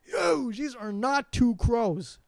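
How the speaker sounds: astonished dude-style exclaim; amazed exclaim. Retold or imitated. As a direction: marvel at the huge birds. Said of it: "And I'm like".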